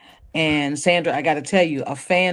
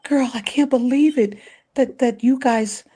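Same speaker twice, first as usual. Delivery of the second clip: lightly voiced [name], higher and moved; a touch of [name]; 7 semitones higher than usual. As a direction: quote her, higher, almost tearful. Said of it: "she was like".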